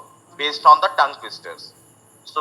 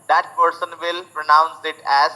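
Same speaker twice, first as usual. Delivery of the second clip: ordinary delivery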